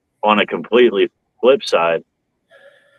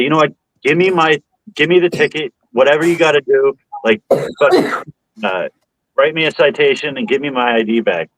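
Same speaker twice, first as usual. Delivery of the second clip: heated demand; 7 semitones higher than usual. Retold or imitated. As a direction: push back hard and insist on getting the ticket. Retold argument, demanding ticket.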